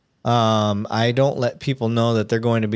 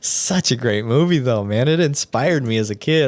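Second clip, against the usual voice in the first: usual speaking voice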